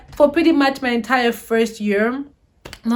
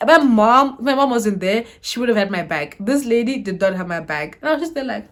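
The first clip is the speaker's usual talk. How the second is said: usual speaking voice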